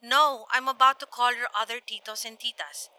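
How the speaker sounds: soft, resigned granny reply; softly voiced grandmother; grandmother on phone. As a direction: answer quietly down the line.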